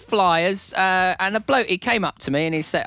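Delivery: high hammed-up British variety comic; Steve. Steve explaining himself at the Russian border, high comic impression. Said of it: "me doing an impression of Steve".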